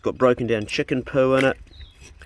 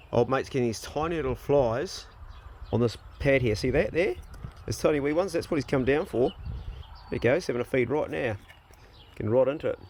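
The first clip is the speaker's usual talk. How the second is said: normal talking voice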